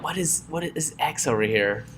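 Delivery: very soft, puzzled mutter; confused muttering. Acted. Coder finding X fifty lines later, confused muttering.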